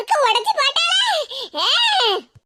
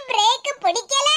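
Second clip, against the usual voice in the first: normal talking voice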